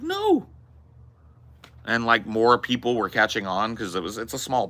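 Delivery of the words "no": clueless no. Retold or imitated. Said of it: "I was like".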